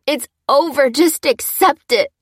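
high, loud despairing shout; screaming cry. A narrator's reading. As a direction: scream at rescuers to stop. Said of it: "screaming at the medics".

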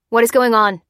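loud, angry demand; growled. A narrator's reading. Lieutenant coming up the stairs, growled. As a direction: demand answers with force. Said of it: "growled the lieutenant".

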